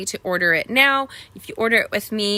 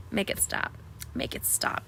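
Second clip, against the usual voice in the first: whispered plea; 12 dB quieter than usual. Real, not acted. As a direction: whisper make it stop, pleading. Dreading daughter turning seventeen.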